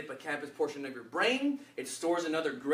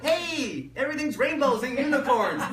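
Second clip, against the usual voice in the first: mock cheery; 9 semitones higher, 6 dB louder than usual. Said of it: "and you're just like".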